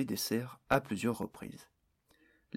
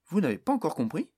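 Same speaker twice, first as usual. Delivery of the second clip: higher, louder exasperated exclamation; exclaiming; 6 semitones higher, 5 dB louder, faster than usual. A narrator's reading. Barnes exclaiming at the stubborn orc, loud exclamation. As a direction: throw it out in disbelief. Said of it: "s'exclama Barnes".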